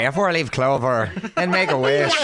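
put-on Irish accent. Host answering wife's random question, mock Irish accent. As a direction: say it with a playful Irish lilt. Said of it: "that was my Irish accent".

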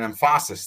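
emphasis said as em-FAH-sis; wrong emphasis. Shown as a demonstration. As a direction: shift the stress in emphasis to FAH. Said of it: "emphasis on the wrong syllable".

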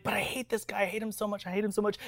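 put-on high-pitched, venting kid complaint; imitated childish rant. Sixth grader rants to mom about teacher, high and upset. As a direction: whine it high like a fed-up child.

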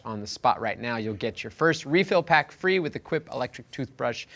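slow, measured offer read; podcast ad read. Acted. Host gives listeners the sponsor's offer, ad read.

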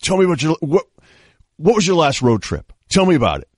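imitating a friendly guy who's always in a good mood; upbeat Steroid Rick. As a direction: pester him cheerfully about his trip. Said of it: "He'd be like".

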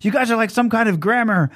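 hammed-up dictator rant; Hitler parody rant. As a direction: go way up, outraged and flustered. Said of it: "And he's like".

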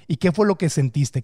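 usual speaking voice

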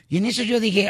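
ordinary delivery

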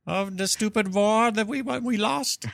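high, slow mock lament; sulking German. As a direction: whine it like a sore loser. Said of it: "Do you think they sit around going".

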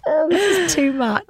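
high, breathy rush of delight; oh this is too much in a high gush; overwhelmed gush. Retold or imitated. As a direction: gush like a starstruck fan. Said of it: "I was like".

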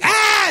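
sudden high yelp of shock; startled scream. Retold or imitated. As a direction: let out a short, very high scream. Shocked by the man's voice.

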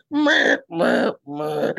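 high mock bleating noise; embarrassed bleat. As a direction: bleat like a flustered goat. Embarrassed bleating after rebuff.

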